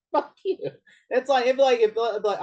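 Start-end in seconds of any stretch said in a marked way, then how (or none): 0.1-0.6 higher mock outburst; mock angry retort. Ron Perlman answering Fox, mock angry. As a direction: spit it out, playfully offended. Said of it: "And Ron Perlman's like".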